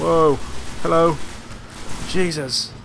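high, loud startled yelps; startled shouts. Real, not acted. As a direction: shout in surprise. Swarmed again.

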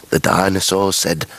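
ordinary talk

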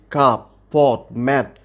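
কাপ, পট, ম্যাট as three separate single beats; one syllable each. Shown as a demonstration. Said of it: "সবই কিন্তু আমরা একবারে উচ্চারণ করছি". (কাপ, পট, ম্যাট প্রতিটি একবারে)

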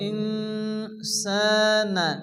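ইনসানা with a long nasal hum on n before s; slow ইনসানা, humming into the s; ghunna. Shown as a demonstration. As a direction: draw out the hidden n of ইনসানা through the nose. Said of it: "এক আলিফ গুন্না করে".

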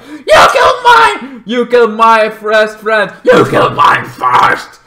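loud, furious yelling, slowing on the words; a hammed-up shouting match over dead friends; angry accusing shout. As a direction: scream the blame back and forth. Two sides yelling accusations.

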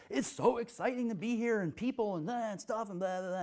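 high, breathless gush of excitement; giddy overexcited teacher. Retold or imitated. Imitating an overexcited martial arts teacher in a high giddy gush. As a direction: gush like a guy who won't sleep for two days. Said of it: "because he's just like".

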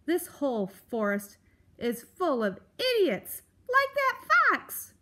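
grumpy build to a high, loud yell; exasperated rant. Acted. Grump rails about idiots in the forest, exasperated. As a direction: start testy, explode on the last words.

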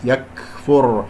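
"يكفر" slow, with a light puff after k; breath on kaf. Shown as a demonstration. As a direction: release a soft airy k in "يكفر". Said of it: "كاف ساكنة فيها همس". (همس الكاف في "يكفر")

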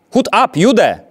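high, loud barked German command; German soldier's yell. A narrator's reading. Gendarme screaming at the father, harsh yell. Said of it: "wrzasnął".

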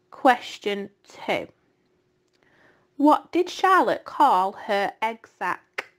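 slow, measured question reading; quiz reading. Acted. Teacher reads the slide questions to pupils, slowly. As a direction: read each question slowly for the class.

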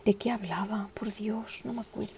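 hushed, forgetful murmur; whispered aside. Real, not acted. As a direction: whisper to yourself, trying to remember. Forgets topic mid-sentence.

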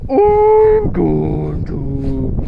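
slow, drawn-out whale call; whale impression. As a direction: stretch every word like a whale. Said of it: "my embarrassingly good whale impression".

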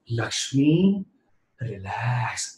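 very soft, low, soothing relax; calming self-talk. Shown as a demonstration. Speaker showing friends how to calm themselves, hushed. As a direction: hush yourself down, sinking low on relax. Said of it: "please tell yourself".

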